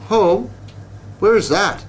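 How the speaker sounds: high, puzzled woodpecker voice; a chatty bird asking in wonder; curious woodpecker. A narrator's reading. Woodpecker asks the bug where home is, high and curious.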